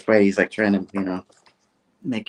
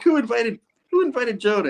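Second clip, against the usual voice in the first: much higher whining voice; exasperated mock. Peter complains that Jonah was invited, in a high exasperated whine. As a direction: groan about the guest. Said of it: "It's like".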